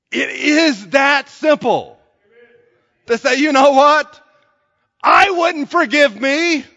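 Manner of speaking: loud, high, rising sermon climax; full-force preaching. Acted. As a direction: preach it out to the whole church, full voice.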